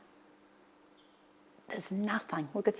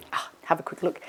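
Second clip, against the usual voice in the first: ordinary talk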